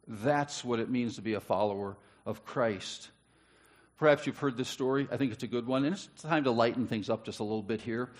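normal talking voice

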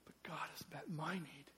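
soft breathy whisper of wonder; awed whisper. Retold or imitated. Blind friend marveling over lunch, hushed whisper. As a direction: let it fall to a hush.

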